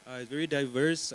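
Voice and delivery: usual speaking voice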